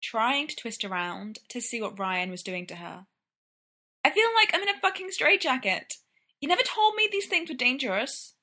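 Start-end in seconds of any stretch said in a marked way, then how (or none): 4.0-8.4 high-pitched, aggrieved whine; whiny complaint. A narrator's reading. Brendan stuck in tangled laces complaining to Ryan, whining. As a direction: protest in a much higher voice.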